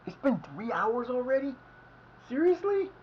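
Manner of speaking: high-pitched disbelief; suddenly high, incredulous; surprised. Real, not acted. Noticing how long he has played.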